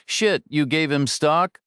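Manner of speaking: high, slow disbelief; can't believe what he just heard; incredulous. A narrator's reading.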